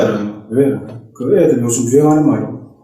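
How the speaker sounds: usual speaking voice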